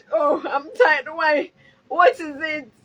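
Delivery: loud tearful wail of tired; crying out tired. Real, not acted. Vlogger crying out to viewers that she's tired. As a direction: cry out that you're tired. Cry heard, tired lament.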